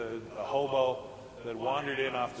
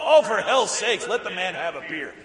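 loud, higher exasperated snap; exasperated outburst; 4 semitones higher, 11 dB louder than usual. Retold or imitated. Father-in-law scolding son-in-law, loud and exasperated. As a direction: blow up at the lecturing son-in-law. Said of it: "He turns to me and goes".

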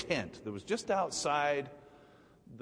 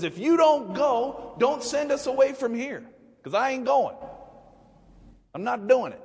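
high, loud digging-in refusal; defiant high-pitched ultimatum; stubborn Moses; 11 semitones higher, 6 dB louder than usual. Acted. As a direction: plant your feet and refuse, voice up high.

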